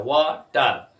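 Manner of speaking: वाटर in two separate beats, वा and टर; teacher's split-up वाटर; syllable by syllable. Shown as a demonstration. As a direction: break वाटर between वा and टर. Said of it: "टर अलहदा है".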